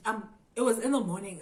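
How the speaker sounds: normal talking voice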